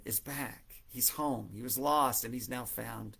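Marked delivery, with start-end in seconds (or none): none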